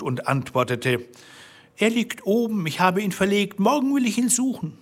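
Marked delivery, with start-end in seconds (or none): 1.8-4.8 high, hurried excuse in a small woman's voice; high-voiced evasive answer; anxious wife. A narrator's reading. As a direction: play the wife fibbing to her husband, pitched well up. Wife lying to Bluebeard, frightened.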